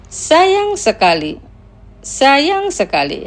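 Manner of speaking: Sayang sekali said slowly, stress on sayang; teacher modelling Sayang sekali at a slow pace; slow pronunciation demo. Shown as a demonstration. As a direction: draw out Sayang sekali for learners to repeat. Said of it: "Los pronunciaré lentamente".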